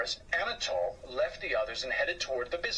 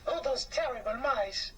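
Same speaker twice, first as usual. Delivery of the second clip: higher, fussy housewife voice; a complaining French woman, pitched up; 11 semitones higher than usual. Acted. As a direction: whine about the mice like a fussy lady. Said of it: "complained a woman".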